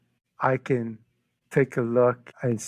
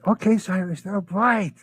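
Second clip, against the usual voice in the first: higher-pitched, exasperated going-along; humouring; 8 semitones higher than usual. Retold or imitated. Friend giving in about the daffodils, humouring him.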